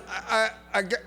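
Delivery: imitating a halting, nervous interviewer; nervous stammer. Nervous interviewer offering the job to an applicant, hesitant stammer. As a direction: stumble reluctantly into the offer. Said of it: "she's really nervous".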